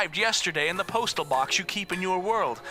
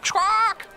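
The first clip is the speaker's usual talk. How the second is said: bird squawk; 13 semitones higher, 5 dB louder than usual. Acted. Bird messenger arrives.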